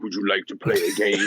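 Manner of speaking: low, menacing horror movie villain; creepy low puppet-master threat; Saw villain voice. Retold or imitated. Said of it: "It would be like the Saw movies".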